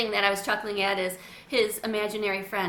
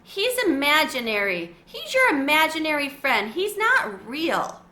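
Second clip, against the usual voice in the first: louder, higher, exasperated insistence; annoyed; 5 semitones higher, 5 dB louder than usual. A narrator's reading. Felipe annoyed at Carlos, exasperated and louder. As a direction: hammer home that he's not real. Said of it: "Felipe was annoyed".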